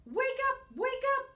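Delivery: high, loud alarmed shout. A narrator's reading. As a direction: yell to rouse a sleeping bear. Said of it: "cried Ladybug".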